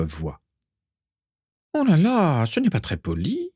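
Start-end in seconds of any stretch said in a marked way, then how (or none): 1.7-3.5 high, squeaky mock reproach; squealing. A narrator's reading. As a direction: squeal it like a whiny ghost girl. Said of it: "couina Mimi Géniard". (couinement aigu et offusqué)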